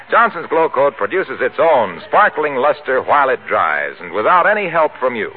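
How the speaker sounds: ordinary delivery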